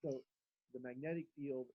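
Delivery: faint and far off, barely audible; muted mic, voice fading away; muffled mic. Real, not acted. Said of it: "[name], we can't hear you".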